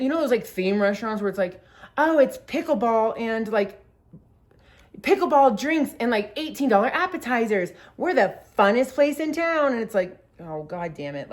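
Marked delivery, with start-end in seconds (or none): 2.0-9.7 higher, hyped-up restaurant promo voice; loud, gushing mock advertising; mock sales pitch. Mocking theme restaurant's sales pitch. 10.4-11.3 resigned groan. Retold or imitated. Resigned reaction to the restaurant.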